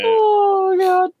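high squeal of dismay; shocked high Oh God. Real, not acted. Player reacts in high shock.

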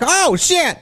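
high-pitched startled exclamation, played for laughs; hammed-up startled yelp; mock shock. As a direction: imitate someone caught completely off guard. Retold shock at the reveal.

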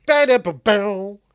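loud, drawn-out "ba-da-ba-bow" sting; punchy vocal riff; mock bass riff. Sings sitcom theme riff, interrupting.

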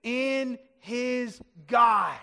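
loud, very slow proclamation; emphatic repetition. Shown as a demonstration. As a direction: hammer it out word by word for the crowd. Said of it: "Say it again".

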